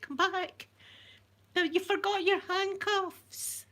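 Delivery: high, drawn-out pretend holler; mock calling after someone. Pretend calling after fleeing man.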